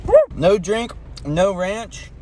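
ordinary talk

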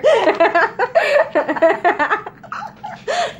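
long, high laughing fit; real laughter. Real, not acted. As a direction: crack up in a high peal. Hands are picking up air.

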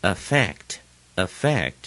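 affect with weight on FECT; second-syllable stress. Shown as a demonstration. Teacher repeating the model, affect stressed on FECT. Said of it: "重音在第二个音节".

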